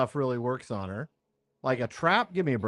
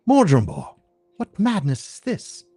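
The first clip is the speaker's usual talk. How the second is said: high, haughty villainess scolding; scornful sorceress; 5 semitones higher, 5 dB louder than usual. Acted. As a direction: sneer at him from above. Sorceress scorns her rival.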